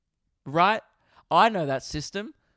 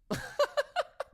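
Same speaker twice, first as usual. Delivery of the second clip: high giggle; 5 dB quieter than usual. Real, not acted. Laughing at punchline.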